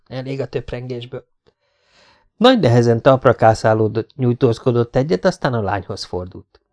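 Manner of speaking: ordinary delivery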